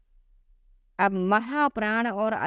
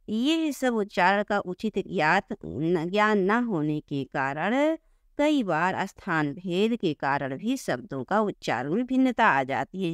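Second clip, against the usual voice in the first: ordinary talk, nothing marked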